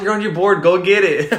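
loud, high re-enacted shout; retold yelling. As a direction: call it out as if yelling down a hill. Retold yelling after the runaway board.

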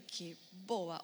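"Qué boba" said low, slow and soft to herself; quiet inner voice. Retold or imitated. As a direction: mutter it slowly under your breath, a little deflated. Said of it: "y yo por dentro dije". (murmurando despacio para sí misma)

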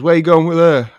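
high, loud alarmed challenge; mock-indignant yell; calling out. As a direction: shout after someone walking off with the baby. Grandfather calls after baby-taker.

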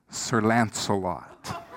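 slow, soft announcement of Sir Lancelot; hushed dramatic reveal. Retold or imitated. Preacher hushing the church for the name, slow and soft. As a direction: let the name land quietly, slowly.

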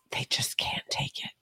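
breathy whispered aside. Real, not acted. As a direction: drop to a whisper. Whispered aside about liberals.

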